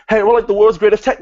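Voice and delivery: high loud cocky boast; mocking hype voice. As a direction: brag like a hype tag team. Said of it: "Remember Gable and Jordan?".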